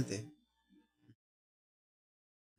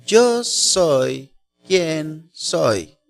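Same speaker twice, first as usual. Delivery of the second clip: Yo soy quien soy, each word drawn out very slowly; solemn slow meaning; 3 semitones higher, much slower than usual. Shown as a demonstration. Preacher explaining the name to the congregation, very slow and solemn.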